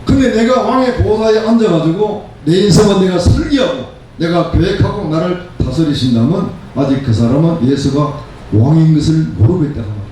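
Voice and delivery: ordinary talk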